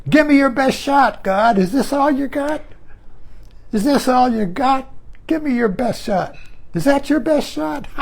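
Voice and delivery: playful defiance shouted up at the sky; defiant challenge to God. Pretend. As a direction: dare God to do his worst, half laughing. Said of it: "you look up at God and say".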